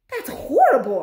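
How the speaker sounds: put-on loud horrified boy; cried out. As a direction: cry out in horror. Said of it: "I cried".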